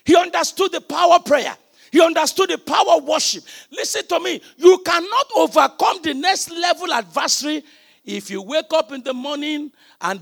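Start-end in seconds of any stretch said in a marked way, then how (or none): 0.0-7.6 high, loud preacher's shouting; full-force preaching. Acted. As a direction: preach it out to the congregation.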